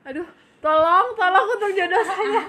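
loud, laughing half-crying plea; laughing mock plea. Real, not acted. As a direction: beg for help through laughter. Scared talk of own future marriage.

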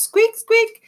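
very high squeaky mouse noises; mocking squeaks. The rat mockingly squeaking at the toddler. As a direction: squeak like a tiny mouse to tease.

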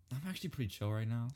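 low, soft, quick dismissal; unimpressed brush-off. Retold or imitated. Girl brushing off a dude's compliment, low-key. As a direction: toss it off quietly and coolly. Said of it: "She's like".

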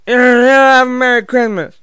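high, loud, drawn-out holler; booming put-on holiday cheer; Irish Santa greeting. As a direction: bellow it as a rowdy Santa, stretching the first sound. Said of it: "he'll say".

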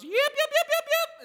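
very high, loud yipping call; shepherd's call. Shown as a demonstration. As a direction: yip up to the flock from the doorway. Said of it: "walks in through the door and goes".

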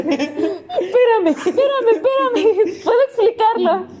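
high, slow laughing pleas to wait. Real, not acted. Pleading to friend through laughter to let her explain. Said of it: "laughing, begging to explain".

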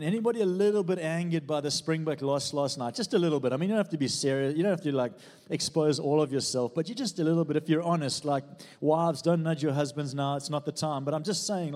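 rally the room with a grin; playful preaching. Real, not acted. Pastor teasing congregation about the Springbok loss, loud and playful.

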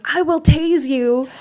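slow, loud, stern police warning; stern cop. Retold or imitated. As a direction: threaten like a tough cop. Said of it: "this cop ... he goes".

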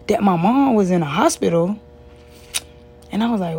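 ordinary talk, nothing marked

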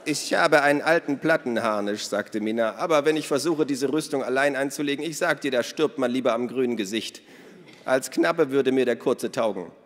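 loud, high, hearty woman's voice; boisterous Minna. Acted. Minna tells the children about their father, boisterous. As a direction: play her big and bold.

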